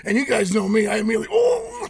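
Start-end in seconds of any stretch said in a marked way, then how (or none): 1.3-1.9 high, held "oh" of imitated awe; geeking out in awe. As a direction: act out a starstruck gasp, held high. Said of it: "I geek out on all that stuff".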